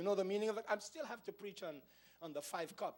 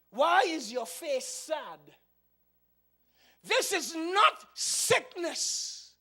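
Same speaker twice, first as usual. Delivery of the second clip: booming, louder and higher, slowed royal challenge; voiced king; 11 semitones higher, 13 dB louder, much slower than usual. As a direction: demand it like a king on his throne. King questions Nehemiah in story.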